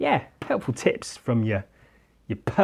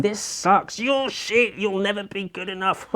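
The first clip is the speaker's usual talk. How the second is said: higher nasty taunting voice; mocking art voice; 7 semitones higher than usual. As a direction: voice the jeering drawings. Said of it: "the art is screaming at him".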